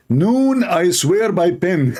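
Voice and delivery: high, mock-solemn reading; mock-solemn, half laughing. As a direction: pretend solemnity, then a laugh breaking through. Mocking the verse's translation.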